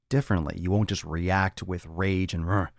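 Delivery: usual speaking voice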